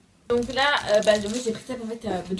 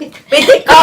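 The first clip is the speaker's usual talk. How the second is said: laughing; 6 semitones higher, 12 dB louder than usual. Real, not acted. Friends laughing at a tasting.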